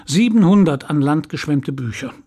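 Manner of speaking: normal talking voice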